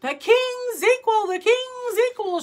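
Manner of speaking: high, ringing proclamation; shouted. A narrator's reading. Wisest counselor announcing the king's equal, shouted. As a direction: cry it out like a herald. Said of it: "shouted the wisest of the counselors".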